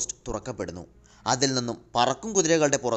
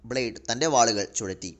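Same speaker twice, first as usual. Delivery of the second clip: plain reading voice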